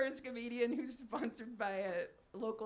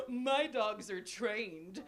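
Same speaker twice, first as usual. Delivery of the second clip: snooty millionaire; 4 semitones lower, much slower than usual. Retold or imitated. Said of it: "And he said".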